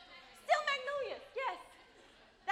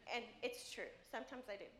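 ordinary talk

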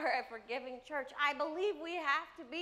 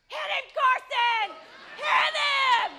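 loud high egging-on yell; hammed-up loud cheering; cheering shout; 11 semitones higher, 14 dB louder, slower than usual. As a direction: scream it like a ringside fan. Mom cheering son to hit.

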